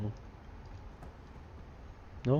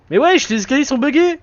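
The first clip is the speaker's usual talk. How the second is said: higher, louder exasperated cry; frustrated outburst; 10 semitones higher, 5 dB louder than usual. Real, not acted. Gamer yells at the buggy stairs, exasperated. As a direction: yell at the broken stairs.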